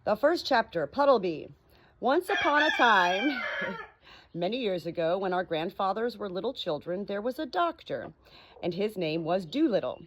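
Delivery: lively storybook reading, rising and falling; story narration. A narrator's reading. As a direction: read it like a bedtime story to friends. Reads story aloud.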